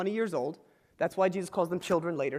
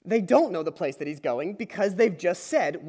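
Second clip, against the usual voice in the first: ordinary talk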